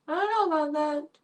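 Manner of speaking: high, hammed-up doubtful voice; mock dramatic. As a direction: play it as a melodramatic doubter. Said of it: "Dramatic pause".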